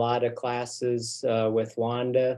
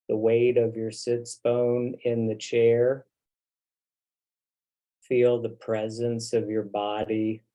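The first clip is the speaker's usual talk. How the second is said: unhurried, settling meditation voice with long pauses; guided meditation. Real, not acted. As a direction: guide them gently into the body, leaving long silences. Leads the group into meditation.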